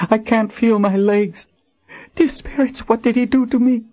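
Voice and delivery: high frightened woman's voice rising in panic; panicked woman. Acted. Wounded Colin cries out to Richard, panicked. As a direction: let the fear climb. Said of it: "Her voice quivered with panic".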